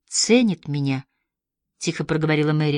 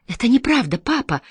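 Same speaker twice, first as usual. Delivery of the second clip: higher protesting cry; 7 semitones higher than usual. A narrator's reading. Daughter protesting to her father, higher-pitched cry. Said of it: "выкрикнула Мэри".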